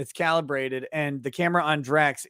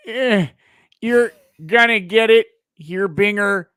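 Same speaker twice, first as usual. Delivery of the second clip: higher, louder Wisconsin accent, hammed up; 3 semitones higher, 7 dB louder than usual. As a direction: put on a goofy Wisconsin accent. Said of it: "hear you in a Wisconsin accent imitating Richards".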